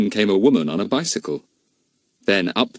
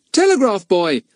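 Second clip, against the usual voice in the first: put-on young boy; 13 semitones higher, 5 dB louder than usual. A narrator's reading. Pip voiced in story.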